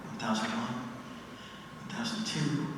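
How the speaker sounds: soft, slow counting to calm down. Shown as a demonstration. Athlete before a big meet shows how she counts, soft and slow. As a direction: count softly to steady your nerves. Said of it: "I count".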